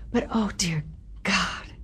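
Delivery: hushed, slow whisper of dismay. Real, not acted. Recalling her worst stage night, a slow whisper. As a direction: breathe it out in dread.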